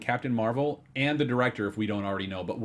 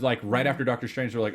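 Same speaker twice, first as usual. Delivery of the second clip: normal talking voice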